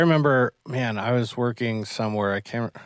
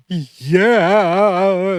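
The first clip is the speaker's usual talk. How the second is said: long, high, loud mock wail; imitated crying; 5 semitones higher, 5 dB louder than usual. Scared Yuvi answers coach, imitated crying. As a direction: wail it like a scared, sobbing kid. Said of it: "shaking and crying".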